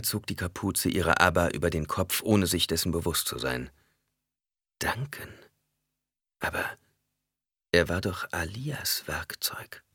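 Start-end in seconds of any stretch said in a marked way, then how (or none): 4.8-9.8 breathless whispered thought. A narrator's reading. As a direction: hush it as a private thought. Inner shocked thought.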